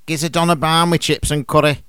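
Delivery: lad's Manc greeting; Manc accent. Shown as a demonstration. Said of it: "listen to their equivalents in Mank dialect".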